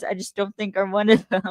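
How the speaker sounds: ordinary talk, nothing marked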